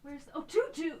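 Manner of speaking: normal talking voice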